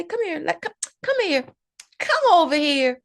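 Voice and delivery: mock stern teacher summoning a pupil; calling a child over. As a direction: call him over like he's in trouble.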